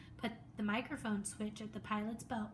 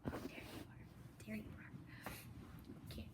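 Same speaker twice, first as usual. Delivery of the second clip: whisper; 14 dB quieter than usual. Real, not acted. Aside to a pet, whispered.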